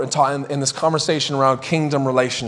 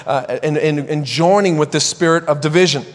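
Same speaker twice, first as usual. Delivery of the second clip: ordinary delivery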